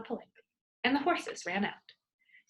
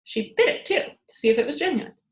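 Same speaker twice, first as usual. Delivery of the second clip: plain reading voice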